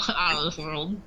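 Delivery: talking through a mouthful; words round a bite of pizza; mouth full. Real, not acted. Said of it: "I'd laugh, but my mouth is full".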